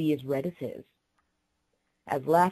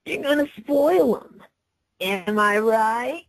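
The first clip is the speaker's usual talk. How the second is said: louder, higher, laughing taunt to the crowd; teasing call; 4 semitones higher, 6 dB louder, slower than usual. A narrator's reading. Prankster calls out to the shop's patrons, teasing. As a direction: play to the audience, gleefully mocking. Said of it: "she called teasingly to the other patrons".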